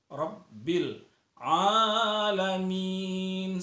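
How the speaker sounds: "Robbil" with a heavy ra, "alamin" slowly chanted; thick ra. Shown as a demonstration. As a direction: recite "Robbil alamin" slow with full ra. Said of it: "ronya ditebalkan".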